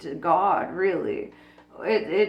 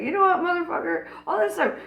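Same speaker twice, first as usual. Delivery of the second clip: mock yelling at God; 7 semitones higher, 4 dB louder, faster than usual. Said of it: "I can yell at God and be like".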